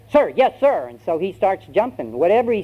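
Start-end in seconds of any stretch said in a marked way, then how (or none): 0.1-0.9 high, barked recruit reply; drilled recruit. Acted. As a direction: snap back an instant answer to the sergeant. Recruit answers the order.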